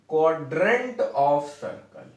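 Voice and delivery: very slow, each word spaced for note-taking; slow dictation. Shown as a demonstration. Teacher dictating a definition to students, very slow and even. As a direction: spell it out slowly so students can write.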